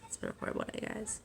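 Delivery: near-whisper, voice almost gone; breathy whisper as the voice fails; voice giving out. Real, not acted. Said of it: "I'm starting to lose my voice a little bit".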